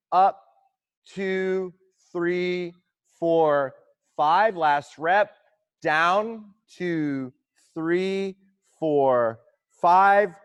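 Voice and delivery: loud, rhythmic coach counting cadence; counting squat tempo aloud over music; calling out rep counts. Real, not acted. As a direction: drive the class through each count.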